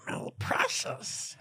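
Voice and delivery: slow, whispered creature voice; Gollum whisper. Pretend. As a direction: breathe it out like a hunched creature. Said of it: "It was kinda like that".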